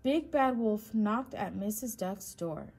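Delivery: ordinary delivery, nothing marked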